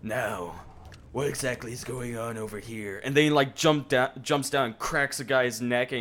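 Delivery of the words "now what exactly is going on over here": gruff Batman-style hero. Retold or imitated. Batman-style cop voicing Kingston.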